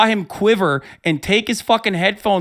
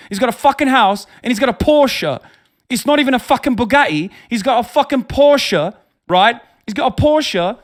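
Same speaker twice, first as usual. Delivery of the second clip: lightly British-accented mock tirade about the Porsche; mock Tate British rant; 4 semitones higher, 5 dB louder than usual. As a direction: parody a British podcast bro ranting, hammed-up. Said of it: "let me tell you exactly what's gonna happen".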